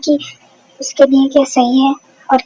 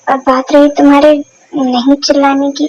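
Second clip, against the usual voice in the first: usual speaking voice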